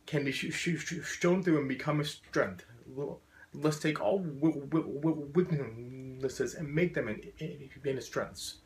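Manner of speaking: repeated first sounds on key words; stuttering. Real, not acted. Speaker urging turning weakness into strength, stuttering. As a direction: push through each block and carry on. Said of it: "as someone with a very profound stutter".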